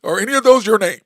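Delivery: a father firmly setting his son straight; dad's pointed retort. Retold or imitated. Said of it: "He goes".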